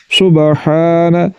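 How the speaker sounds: सुबहान drawn out slowly; slow recitation. Shown as a demonstration. Teacher reciting the word slowly for practice. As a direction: stretch सुबहान gently. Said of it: "हलक के दरमियान वाले हिस्से से".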